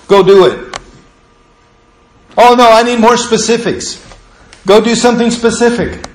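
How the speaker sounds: loud, high-pitched preacher pushing hard; fiery preaching. Acted. Pastor urging the church to the altar, loud and fervent. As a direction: drive the altar call home at full force. Altar call at full force.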